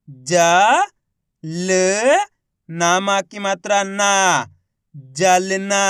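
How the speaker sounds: ज, ल, long ना, then जलना; slow spelling into a careful जलना; letter by letter. Shown as a demonstration. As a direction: sound out each letter, then blend. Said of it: "एक अक्षर का उच्चारण करके".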